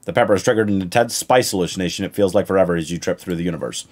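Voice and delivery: ordinary talk, nothing marked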